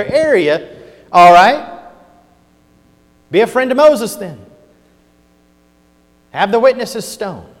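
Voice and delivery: preacher confronting the accusers; fiery preaching. Acted. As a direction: throw it at them as a challenge.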